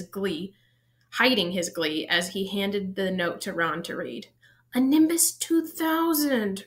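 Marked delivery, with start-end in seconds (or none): 4.7-6.6 high, slow envious moan. A narrator's reading. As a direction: moan it with longing, slowly. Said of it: "Ron moaned enviously".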